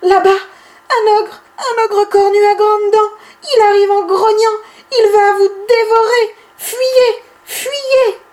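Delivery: feigned terrified alarm, high and loud; faux cri effrayé. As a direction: cry out in mock panic about an ogre. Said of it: "Bibi prend un air très, très effrayé". (crier de fausse frayeur, aigu et fort)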